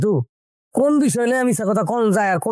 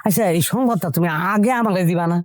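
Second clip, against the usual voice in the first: normal talking voice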